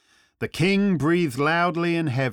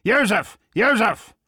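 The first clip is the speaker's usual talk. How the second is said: loud, high summons; calling out; 7 semitones higher, 6 dB louder than usual. A narrator's reading. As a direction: call urgently for a servant.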